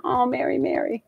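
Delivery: soft, hushed and tender, falling away; tender sigh of her name. Real, not acted. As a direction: breathe her name out softly, deeply moved. Moved, tenderly addressing client.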